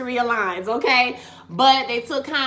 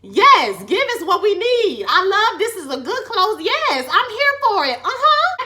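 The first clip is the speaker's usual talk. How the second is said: excited cheering; 8 semitones higher, 6 dB louder than usual. Retold or imitated. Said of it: "I was like".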